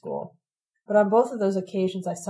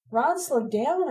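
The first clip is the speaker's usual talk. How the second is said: slow, high plea; pleading; 6 semitones higher, much slower than usual. A narrator's reading. Frightened narrator begs Ron to slow down, pleading. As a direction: beg him, drawn out. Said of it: "I pleaded".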